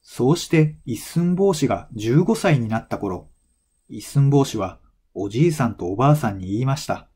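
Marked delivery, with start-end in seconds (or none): none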